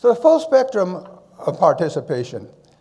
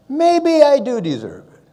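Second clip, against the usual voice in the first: louder, higher, insisting he earned it; emphatic self-assurance; 8 semitones higher, 5 dB louder than usual. Retold or imitated. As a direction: declare it with mock pride. His reply at the medal ceremony.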